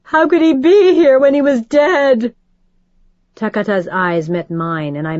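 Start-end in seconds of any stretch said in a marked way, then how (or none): 0.0-2.3 high, loud tearful wail; distraught mother wailing. Acted. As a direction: keep wailing in confusion.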